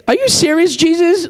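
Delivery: high-pitched mock disbelief; acted-out exasperated question; incredulous. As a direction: challenge him as if it's absurd. Voices the disbelieving disciples.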